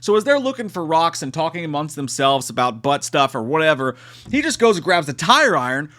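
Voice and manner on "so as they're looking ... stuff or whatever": ordinary delivery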